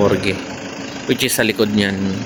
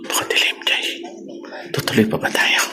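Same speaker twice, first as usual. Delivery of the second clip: whispering; 7 dB quieter than usual. Real, not acted. Dark morgue, hushed.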